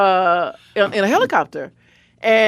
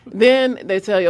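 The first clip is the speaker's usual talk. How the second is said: ordinary talk, nothing marked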